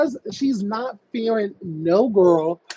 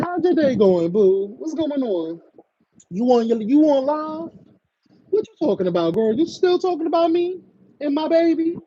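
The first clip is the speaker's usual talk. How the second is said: higher, lilting pretend small talk; mock-friendly chat; 7 semitones higher than usual. Host imagining small talk with angry caller, teasing friendliness. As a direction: needle them with breezy friendly questions.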